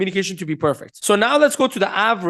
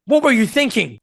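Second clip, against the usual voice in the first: aggressive, attacking question; bad tone; 4 semitones higher than usual. Shown as a demonstration. Coach repeating the bad tonality example, attacking. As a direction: accuse them, pitched up. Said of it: "even though tonality is still bad".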